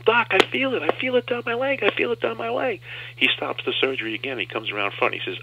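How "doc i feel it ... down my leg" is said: much higher, excited shout; screaming. Retold or imitated. Patient screaming to the doctor, much higher excited shout. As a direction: call out the feeling coming back. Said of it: "I'm screaming at him".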